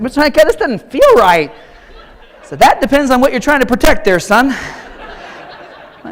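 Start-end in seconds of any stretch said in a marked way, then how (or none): 0.0-1.5 put-on higher, louder kid's complaint; worried kid voice. Boy with his cup on backwards complaining to his coach. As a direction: whine it like a confused boy. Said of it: "He's like".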